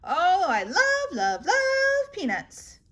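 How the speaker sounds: slow, high, swooning love; gushing. Acted. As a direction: swoon over the popcorn. Gushing over snacks.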